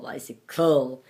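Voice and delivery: bicycle ending in a full "cole"; wrong long O ending. Shown as a demonstration. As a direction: round the last vowel of bicycle to "oh". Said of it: "you don't say bicycle".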